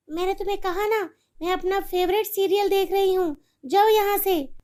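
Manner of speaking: a careless mother brushing off her daughter; irritated mother. Acted.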